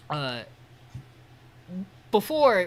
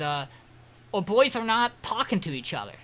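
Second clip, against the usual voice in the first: ordinary talk, nothing marked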